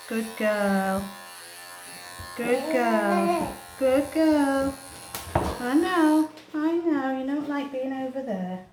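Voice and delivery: sing-song praise for a nervous pet; cooing to the cat. Real, not acted. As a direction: coax a frightened cat. Soothing a scared cat.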